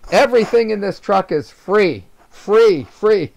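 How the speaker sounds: retold yell. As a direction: call it out to draw a crowd. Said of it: "I stand up on my truck and I yell".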